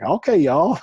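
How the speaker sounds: high, loud mock country accent. Coach imitating a student faking rapport, mock country accent. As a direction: put on a hammed-up country twang. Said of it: "start getting a country accent".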